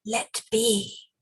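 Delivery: soft, quiet monster voice; gentler creature voice. Acted. Spider creature's gentler side pleads in a hush. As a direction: coax it gently, hushed. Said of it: "repeated the gentler voice".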